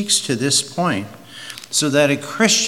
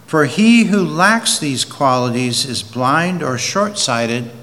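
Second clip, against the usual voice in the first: louder, slow scripture reading; preaching; 5 dB louder than usual. Acted. Preacher reading Peter to the congregation, slow and loud. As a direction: proclaim the verse slowly and strongly.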